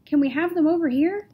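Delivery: loud, high call. A narrator's reading. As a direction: call across the room. Calling out to the crowd.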